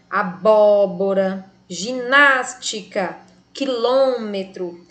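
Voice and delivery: "abóbora", "ginástica", "quilômetro" said slowly with the third-last syllable BÓ, NÁS, LÔ hit hard; ênfase na antepenúltima sílaba; stress the third-last syllable. Shown as a demonstration. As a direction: spell out each word slowly and punch its third-last syllable. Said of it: "a antepenúltima sílaba de cada uma é a mais forte".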